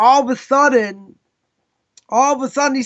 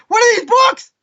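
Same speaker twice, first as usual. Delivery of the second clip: high, loud screaming question; terrified scream; 11 semitones higher, 4 dB louder than usual. Retold or imitated. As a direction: scream it out in terror. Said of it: "He's screaming".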